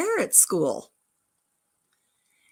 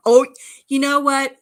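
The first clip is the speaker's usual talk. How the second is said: normal talking voice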